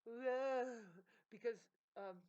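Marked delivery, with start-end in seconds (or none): 0.1-0.9 loud, disgusted groan; exasperated groan. Real, not acted. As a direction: groan it out in frustration. Disgusted at manipulation.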